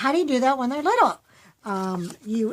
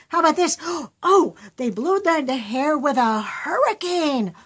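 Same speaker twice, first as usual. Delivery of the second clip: higher, louder, with a gasp; excited child; 5 semitones higher, 6 dB louder than usual. Retold or imitated. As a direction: burst out with a new idea. Child voiced with sudden idea.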